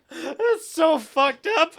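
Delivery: high burst of laughter; laughing in disbelief. Real, not acted.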